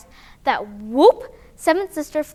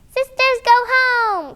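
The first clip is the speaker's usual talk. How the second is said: high loud little call; the baby calls her sisters home, high and loud; baby's cry; 9 semitones higher, 6 dB louder, slower than usual. Acted. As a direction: call out like an eager toddler. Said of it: "cried baby seventh sister".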